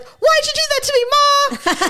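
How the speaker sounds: high-pitched, hammed-up wail to Ma; mock complaint. County worker jokingly protesting her name to her mother, mock complaint. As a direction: put on a squeaky mock whine of protest. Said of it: "and she was like".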